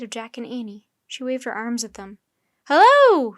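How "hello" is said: high loud call; called greeting across the snow. A narrator's reading. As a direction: hail them from a distance. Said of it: "she called".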